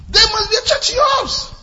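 high, loud preacher's shout; shouted declaration. Acted. Pastor charging the church to host house churches, shouting. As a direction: proclaim it to the back row.

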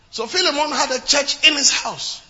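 normal talking voice

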